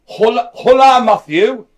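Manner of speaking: put-on Spanish greeting in a loud, hammy German accent. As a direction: bark the Spanish like an angry German. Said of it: "I actually did Spanish there in a German accent".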